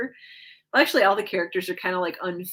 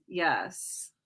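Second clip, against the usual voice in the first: ordinary talk, nothing marked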